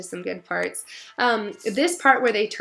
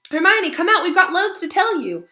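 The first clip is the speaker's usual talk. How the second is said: higher and louder, calling through a door; 10 semitones higher, 6 dB louder than usual. Acted. Ron calls to Hermione through her stall door, loud and eager.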